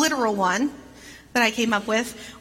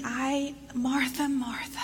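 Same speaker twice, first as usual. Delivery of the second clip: softly breathed name, almost whispered; caring and tender; 8 dB quieter, slower than usual. Shown as a demonstration. Jesus tenderly calls Martha, whispered and much softer. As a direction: call her name like a gentle caress. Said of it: "so caring and endearing".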